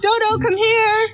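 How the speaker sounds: high, slow summons across the house; calling out. Acted. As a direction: call her out to testify, high. Calling Dodo to testify.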